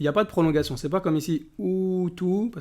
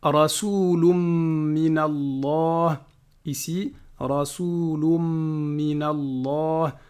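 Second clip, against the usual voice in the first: slow measured chanting of the verse; Quran recitation; much slower than usual. Acted. Teacher reciting Surah Al-Bayyinah to students, chanted. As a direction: recite each word with care.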